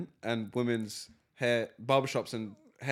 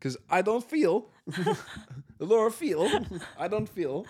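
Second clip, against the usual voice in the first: higher mock imitation of mum's broken English; mimicking mum; 6 semitones higher than usual. Son teasingly imitating his mum's broken English, higher pitch. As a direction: parrot her words teasingly. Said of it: "imitating mum again".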